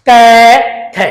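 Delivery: क, ख, said slowly as separate letter sounds; teacher demonstrating letter sounds; sounding out letters. Shown as a demonstration. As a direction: sound out क and ख for the class. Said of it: "जैसे अपन इनका उच्चारण करेंगे".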